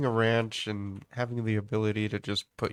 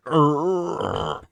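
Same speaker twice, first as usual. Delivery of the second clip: a loud mock growl, higher up; dog growl; 6 semitones higher, 8 dB louder than usual. Old dog answering with a loud mock growl. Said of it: "The dog's just sitting there like".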